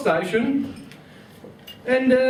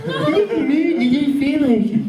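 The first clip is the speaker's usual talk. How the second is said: mocking girlfriend; 5 semitones higher, 3 dB louder than usual. Repeats girlfriend line, sing-song.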